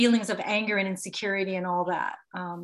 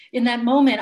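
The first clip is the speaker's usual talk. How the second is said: normal talking voice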